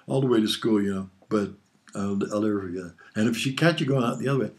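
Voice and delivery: ordinary delivery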